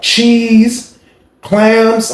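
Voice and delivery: ordinary talk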